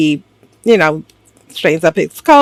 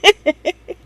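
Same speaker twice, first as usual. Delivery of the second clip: amused laugh; 12 semitones higher than usual. Real, not acted. Recapper amused by her acting, high giggle. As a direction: crack up at your own impression. Cracks up at own impression.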